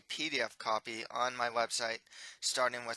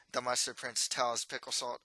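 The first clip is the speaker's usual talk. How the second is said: ordinary delivery, nothing marked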